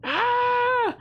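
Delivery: long high-pitched squeal; sustained high "ah" of overwhelmed delight; excited squeal. Real, not acted. Streamer overjoyed by a viewer's sub, excited squeal. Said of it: "I'm just getting excited".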